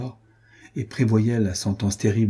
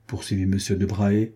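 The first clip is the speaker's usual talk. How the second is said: ordinary delivery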